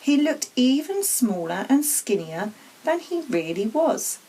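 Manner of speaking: plain reading voice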